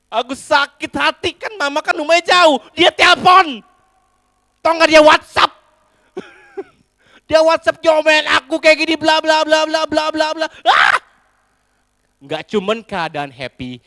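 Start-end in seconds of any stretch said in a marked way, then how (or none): 0.1-5.5 high, loud wounded rant; hurt wife ranting. Acted. Wife complains to husband about his mother, high and loud. 7.2-11.0 high, loud wounded rant; hurt wife ranting. Acted. Wife complains to husband about his mother, high and loud.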